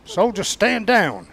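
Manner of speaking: loud, slow, high command. Retold or imitated. MPs confronting the soldier, slow loud command. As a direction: order him down like an MP. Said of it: "they came towards me".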